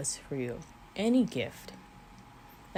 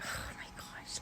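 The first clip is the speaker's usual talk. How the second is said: choked up, breaking into a whisper; tearful whisper; 12 dB quieter, slower than usual. Real, not acted. Overcome while talking about God's grace, whispering through tears. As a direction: voice catching as tears come. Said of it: "go away, tears".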